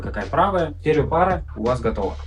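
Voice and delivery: ordinary talk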